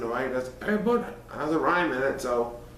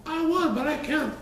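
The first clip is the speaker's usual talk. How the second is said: sobbing; 13 semitones higher than usual. A narrator's reading. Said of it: "sobbed poor Figwit".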